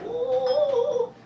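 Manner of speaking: a sudden high delighted "ooh"; excited squeal. Real, not acted. Game night at seven, she squeals with excitement. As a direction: squeal with glee at the thought.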